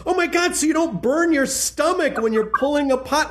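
high, excited retold exclamation; imitating Lydia's delight. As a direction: retell his wife's squeal of discovery. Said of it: "And Lydia goes".

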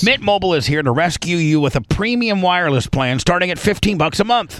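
ordinary talk, nothing marked

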